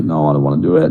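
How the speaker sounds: sulky low refusal. Retold or imitated. Stoned refusal in sauna.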